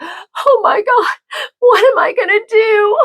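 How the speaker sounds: high, wailing mock sob; imitating crying girl. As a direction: play a panicked girl crying over her test. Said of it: "and she was crying".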